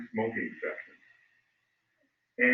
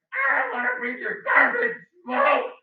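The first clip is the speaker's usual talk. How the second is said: much higher, louder and slow shriek; retold scream; 9 dB louder than usual. Sister screaming at the smoker, slow loud shriek. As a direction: scream it right in his face. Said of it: "just screams at him".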